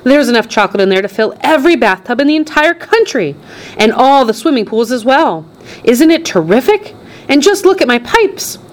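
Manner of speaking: high, gushing showman's patter; excited boasting. A narrator's reading. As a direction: marvel proudly at your own creation. Wonka boasts about his river.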